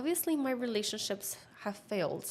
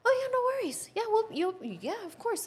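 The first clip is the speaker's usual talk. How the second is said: much higher, bright customer-service voice; breezy office voice; 13 semitones higher than usual. Retold or imitated. Imitating the apartment office clerk on the phone, high and chirpy.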